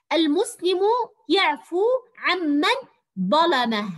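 deliberate classroom reading with عمن said "am-man"; model sentence reading. Shown as a demonstration. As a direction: read it word by word for pupils, leaning on the "mm" of عمن. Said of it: "أقرأ الجملة وألاحظ الحرف المشدد".

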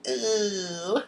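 high, soft, drawn-out ooh; imitated "ooh" reaction. Vlogger imitating viewers hearing about the dish, drawn-out ooh. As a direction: mimic a viewer's long rising ooh. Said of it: "you're gonna be like".

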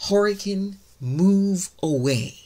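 slow, firm order to the storm; a drawn-out command to the hurricane; commanding claim. Shown as a demonstration. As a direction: claim it with authority. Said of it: "we're gonna claim right now".

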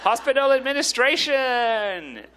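over-keen goody two-shoes cheer, drawn out. Acted. Goody two-shoes cheering a work topic on a night out, over-keen. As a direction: cheer it like an eager swot, stretching it out. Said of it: "some goody two-shoes is like".

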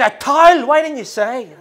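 loud high relieved shout; dad finally understanding. Retold or imitated. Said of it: "He said".

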